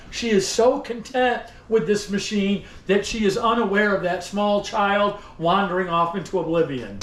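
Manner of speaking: ordinary talk, nothing marked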